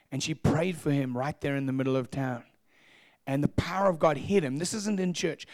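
ordinary talk

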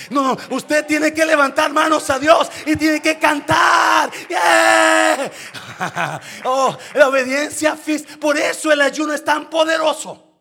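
loud, high-pitched preacher's shout; fiery preaching. Acted. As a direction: preach it at full force to the church. Preaching at full force. (predicación a todo pulmón, alta y fuerte)

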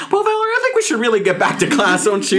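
nervous high voice. Retold or imitated. To Valerie, scared and wanting out, high nervous voice. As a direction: squeak it out, desperate to leave. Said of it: "Your voice goes up three octaves".

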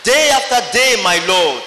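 ordinary delivery

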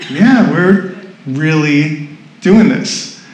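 slow, spaced-out reply at normal pitch; deliberate confirmation. Retold or imitated. Speaker confirming the decision to onlookers, deliberate. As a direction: draw it out, settled and certain.

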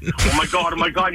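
ordinary talk

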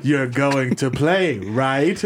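low, very slow threat; slow low menace. Retold or imitated. Nutcracker dangles the baby peanut, slow low threat. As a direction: drag each word out like a cruel villain. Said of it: "picks him up by his fucking feet like".

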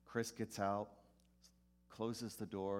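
normal talking voice

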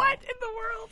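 high shocked cry, breaking into a laugh; shocked disbelief. Real, not acted. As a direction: gasp it out in total disbelief. Shocked at the synopsis.

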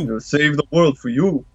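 lightly voiced New York boxer; a hint of Rocky, promising; Rocky impression. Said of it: "sounds like Rocky".

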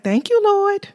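high, thankful cry to the Lord; grateful exclaim. Retold or imitated. Wife thanking the Lord for social distancing, high and joyful. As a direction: lift it up in relief. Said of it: "I was like".

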